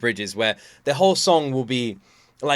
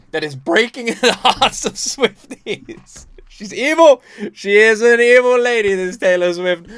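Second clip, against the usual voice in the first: much higher and louder, laughing indignation; mock outrage; 9 semitones higher, 10 dB louder than usual. Real, not acted. Fan mock-accusing Taylor, loud and high. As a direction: cry out in playful horror.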